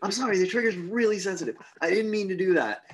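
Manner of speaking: high, hurried mock apology; flustered apology. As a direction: blurt it out like an accidental misfire. Retold gunman apologizing for misfire.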